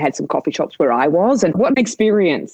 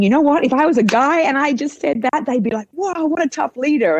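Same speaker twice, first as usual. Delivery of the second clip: higher pitched, ironic retort; mock indignation; 9 semitones higher than usual. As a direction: poke fun at the double standard. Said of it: "I was saying".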